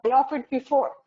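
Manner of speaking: high, loud indignant outburst; exasperated disbelief. Retold or imitated. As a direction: scoff it off as absurd. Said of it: "You're like".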